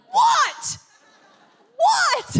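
very high, loud mum shriek in imitation; exasperated yell. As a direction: yell back as a fed-up mum. Exasperated mum yells back.

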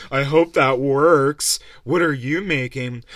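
mock a condescending cooking show judge, slowly; snooty TV judge. Imitating a smug TV cooking judge questioning a contestant, slow and loud. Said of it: "a judge that's like".

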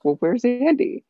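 high, slow, pointed; an office worker questions the new manager; incredulous question. Retold or imitated. Retelling Office scene.

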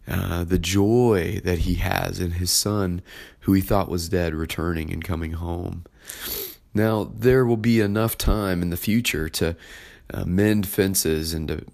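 ordinary delivery

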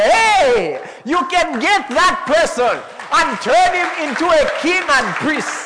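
very high, loud, slow joyful shout; heavenly exclamation. Retold or imitated. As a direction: shout the angels' amazement. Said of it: "They're like".

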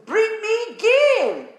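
high, drawn-out command landing on "game"; stress on GAME. Acted. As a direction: stretch it slowly, ringing out "game". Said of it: "there's an emphasis on the word game".

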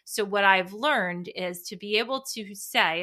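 ordinary talk, nothing marked

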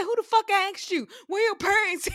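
high, loud mock outrage; angry shouting. Imitating friend scolding little boy, angry shout. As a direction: shout the challenge, high and indignant.

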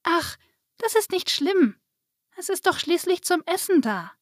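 put-on high, reassuring child voice; little girl. A narrator's reading. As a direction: brush it off as a young girl. Narrator voices Lisa reassuring fairy. (hohe, tröstende Mädchenstimme)

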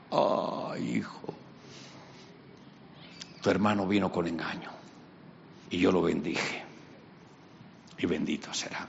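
soft, hushed, regretful; sad old father. Acted. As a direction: break it gently. Isaac sadly explains.

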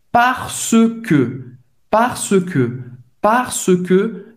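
"parce que" with a closed E in "que", slowly drilled. Shown as a demonstration. Teacher drills "parce que" for learners, closed E in "que". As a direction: round the lips on "que" in "parce que". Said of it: "tem o C E que, que é bem fechado".

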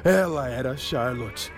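lightly voiced begging hero; humble pleading. Troy pleading.

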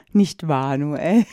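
low, creepy sweet question; psychopath voice. Pretend. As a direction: tease him like a creepy stalker. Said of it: "Hör mal auf, so ein Psychopath zu sein". (tief, unheimlich süßlich)

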